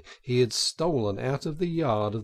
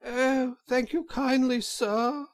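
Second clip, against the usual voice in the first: high, faint put-on washerwoman voice; feeble voice; 13 semitones higher, slower than usual. A narrator's reading. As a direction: Toad playing a weak old woman, much higher. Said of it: "said Toad in a feeble voice".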